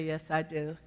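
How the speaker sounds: soft, low answer to an accuser; quiet affirmation. Acted. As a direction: answer back quietly and steadily. Acted humble reply to the accuser.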